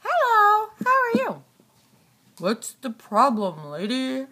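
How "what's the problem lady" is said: low rumbling monster asking; deep monster voice. Pretend. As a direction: boom it out like a big beast. Deep put-on voice.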